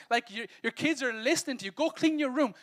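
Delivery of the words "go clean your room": parent order. Retold or imitated.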